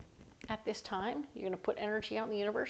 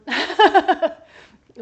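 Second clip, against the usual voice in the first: high, loud burst of laughter; big rolling laugh; 8 semitones higher, 18 dB louder than usual. Real, not acted. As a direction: crack up at your own silliness. Laughs at own accent slip.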